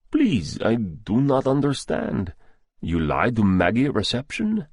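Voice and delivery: put-on high, startled plea in a Russian accent; Russian-accented doctor, suddenly alarmed and confused; puzzled Russian accent. A narrator's reading. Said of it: "He spoke with a thick Russian accent".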